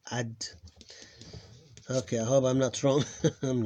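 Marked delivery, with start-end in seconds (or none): 0.1-0.3 ad closed on a held d; final vowel dropped. Shown as a demonstration. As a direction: end ad on the double d with no vowel. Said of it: "Adda become ad".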